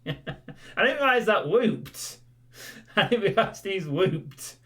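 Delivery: slow words through laughter; laughing. Real, not acted. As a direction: laugh while speaking. Laughing at clip.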